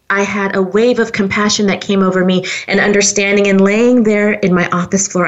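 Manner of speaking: ordinary delivery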